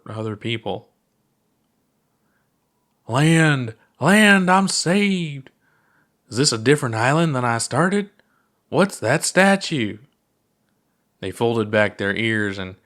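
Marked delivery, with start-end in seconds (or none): 3.1-10.0 mock relief. Puppet pilot reaches land.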